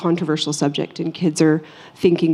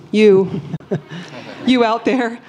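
breaking into a laugh mid-answer, a little higher; 5 semitones higher, slower than usual. Real, not acted. Panelist amused by someone in the audience, laughing. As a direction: laugh it off while pointing someone out.

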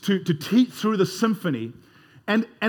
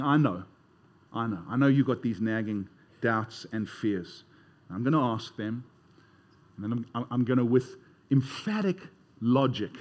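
voicing Paul gently; 9 semitones lower, 6 dB quieter than usual. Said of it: "it's like Paul is saying".

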